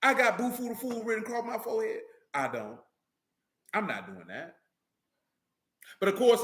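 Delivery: ordinary talk, nothing marked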